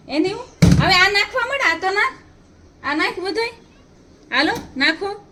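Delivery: mother coaxing her son in a high voice. Real, not acted. Coaxing child to add clothes.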